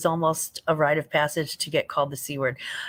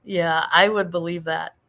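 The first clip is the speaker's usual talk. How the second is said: ordinary delivery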